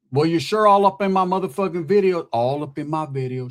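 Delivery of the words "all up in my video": low-pitched mock quote; lower, understated impression on a repeated line; Suge Knight. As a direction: lightly imitate him, dropping the pitch. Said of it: "Suge Knight shit".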